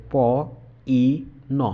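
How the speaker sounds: পিন split into প, ই, ন; sounded out. Shown as a demonstration. Teacher breaking a word into its sounds for students. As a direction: sound out প ... ই ... ন slowly, one sound at a time. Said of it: "শব্দটাকে যদি আমরা একটু ভাগ করে বলার চেষ্টা করি".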